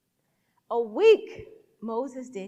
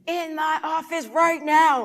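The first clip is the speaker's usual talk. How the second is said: louder, higher angry order; stern summons; 4 semitones higher, 10 dB louder than usual. Retold or imitated. Boss summoning summer worker after the incident, angry order. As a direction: order it loudly, no argument allowed. Lady summons her angrily.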